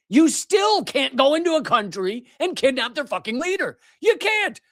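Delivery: high-pitched indignant rant, peaking in a shout; exasperated, voice shooting up; outraged. Real, not acted. Host protesting the kidnapping of a leader, real outrage.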